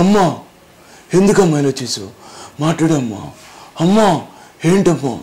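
Nagarjuna. Retold or imitated. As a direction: ask the mother gently, only lightly imitating. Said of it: "ఇలా చెప్పాల్సి వస్తుంది". (నాగార్జున శైలిని తేలికగా అనుకరిస్తూ మృదువుగా)

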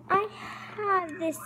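usual speaking voice

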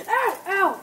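sharp loud high ow; real startled ouch; pained yelp. Real, not acted. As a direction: yelp from a sudden sting underfoot. Stepped on potato, foot hurts.

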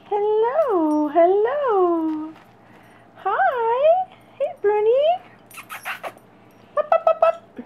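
high cooing hello to a puppy; puppy greeting voice. Real, not acted. Greeting and calling puppies.

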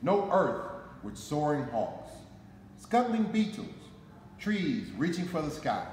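plain reading voice

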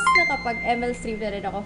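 normal talking voice

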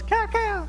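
high nasal-free quack; mama duck call. Retold or imitated. Preacher imitating the mama duck calling her ducklings. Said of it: "And the mama's".

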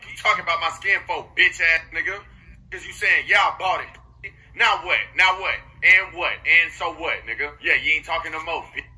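loud, confrontational challenge; angry. Real, not acted. Gamer confronting someone over his skin, loud and angry. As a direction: throw it at them loudly, daring a reply.

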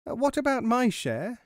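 high, indignant little mouse; mouse crying out. A narrator's reading. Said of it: "he cried".

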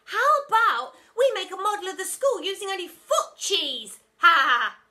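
very high, loud mocking child; cheeky kid. A narrator's reading. Pupil mocking the teacher, high and laughing. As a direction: tease with a laugh.

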